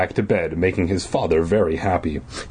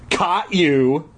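higher acted call; crying out; 8 semitones higher than usual. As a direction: pounce with a raised pitch. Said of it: "he cried".

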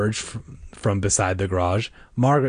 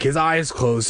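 plain reading voice

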